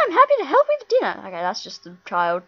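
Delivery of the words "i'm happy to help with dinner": put-on higher, quick girly voice; game girl voiced up high; girl character voice. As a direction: play the sweet little helper girl.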